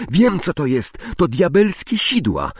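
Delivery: high, urgent exclamation; crying out. A narrator's reading. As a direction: call it out as a sudden alarm. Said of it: "krzyknęła Hermiona".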